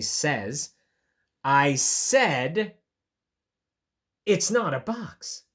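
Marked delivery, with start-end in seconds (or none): 1.4-5.4 firm, louder, with pauses for emphasis; frustrated bunny digging in; emphatic, frustrated. Acted. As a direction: insist it, a bit exasperated. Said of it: "Very emphatically this time, I think Bunny is getting a bit frustrated".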